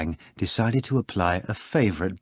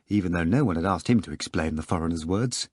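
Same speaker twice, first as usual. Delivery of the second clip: normal talking voice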